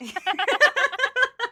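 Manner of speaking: high loud laughter. Real, not acted. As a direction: burst out laughing. Laughing at podcast joke.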